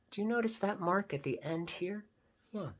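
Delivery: plain reading voice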